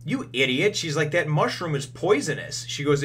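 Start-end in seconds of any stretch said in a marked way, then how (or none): 0.0-0.8 higher, louder mock rebuke; retold scolding. Doctor realising Chopper gave poison mushroom, scolding. 1.1-2.7 higher-pitched, emphatic rebuke; retold alarmed scolding. Doctor telling Chopper the mushroom is poison, scolding.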